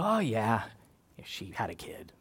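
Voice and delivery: low, soft, offhand shrug of a voice; bored, mundane. Acted. Jaded believer dismissing God's miracle, unimpressed. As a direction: toss it away, dropping almost to a whisper. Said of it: "get old or mundane to us".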